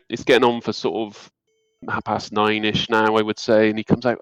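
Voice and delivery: ordinary talk, nothing marked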